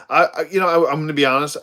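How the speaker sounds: ordinary talk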